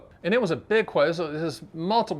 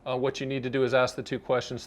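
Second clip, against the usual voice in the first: ordinary talk, nothing marked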